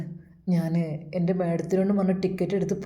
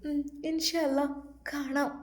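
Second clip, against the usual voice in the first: put-on tearful girl; 6 semitones higher, 7 dB quieter than usual. Bushi's tearful farewell, high soft.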